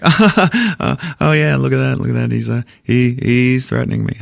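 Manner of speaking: a light imitation of Goldblum's halting, amused delivery; playful nod to Goldblum's stammer; Jeff Goldblum impression. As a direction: hem and haw, delighted by the threat. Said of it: "doing the Jeff Bloom thing".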